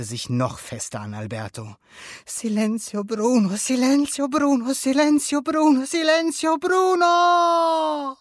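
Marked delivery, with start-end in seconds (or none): 2.3-8.2 frantic high chant building to a long scream. A narrator's reading. Luca clings to Alberto, screaming it frantically. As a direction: repeat it ever higher and louder, desperately. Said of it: "schrie Luca".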